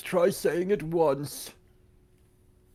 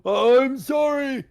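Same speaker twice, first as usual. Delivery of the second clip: acted apology; 7 semitones higher, 4 dB louder, much slower than usual. Judge's acted apology after rebuke.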